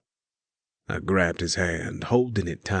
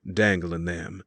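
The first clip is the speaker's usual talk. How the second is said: plain reading voice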